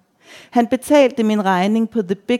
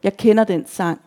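usual speaking voice

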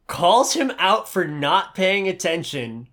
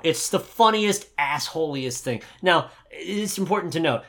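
ordinary delivery

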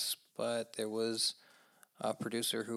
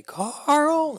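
high, loud drawn-out yell of Carl; Rick Grimes calling Carl; 13 dB louder than usual. Retold or imitated. Host mimics Rick Grimes yelling for his son, loud. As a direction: bellow the name like a desperate dad. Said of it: "I wanna hear him say".

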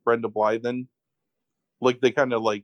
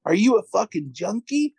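mock screaming; 8 semitones higher than usual. Host acting out man accusing naked date, mock screaming. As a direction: imitate a hysterical accusation. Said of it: "So he starts screaming".

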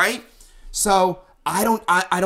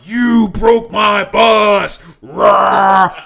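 slow, loud, accusing yell; furious shout; 3 semitones higher, 8 dB louder, much slower than usual. Retold or imitated. Accusing the rival band of breaking his bus, shouting. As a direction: hammer each word, then roar. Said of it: "And I'm like".

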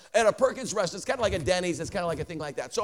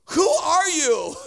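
slow, louder, higher, half-laughing wonder; amazed question; 7 semitones higher, 6 dB louder, much slower than usual. Retold or imitated. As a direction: ask it in stunned disbelief. Tearful waitress asks preacher.

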